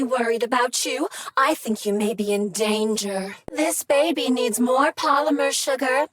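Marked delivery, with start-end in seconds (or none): none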